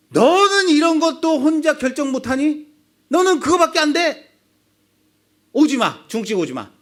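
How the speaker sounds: loud, high exasperated scolding; father's outburst. Acted. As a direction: blow up at the indecisive son. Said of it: "이렇게 버럭하지 않을까요?". (버럭 화내는 아빠)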